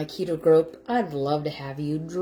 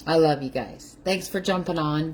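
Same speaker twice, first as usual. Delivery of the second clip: ordinary talk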